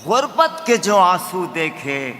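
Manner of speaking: measured, declaimed verse; stately nazm recitation. Acted. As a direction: recite to the audience with weight.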